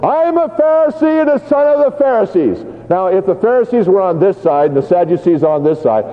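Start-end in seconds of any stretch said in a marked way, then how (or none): 0.0-2.7 much higher, ringing proclamation; crying out at the top of his voice. Acted. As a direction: call it out over the uproar. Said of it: "crying at the top of his voice".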